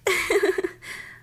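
high, loud burst of giggling; happy laugh. Acted. Learning the crush likes them back, high happy laugh.

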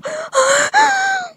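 sudden high gasping cry; sharp high gasp. Real, not acted. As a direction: gasp out at the peak.

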